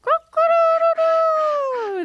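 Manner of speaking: high, slow rooster crow. Retold or imitated. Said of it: "what noise a rooster makes".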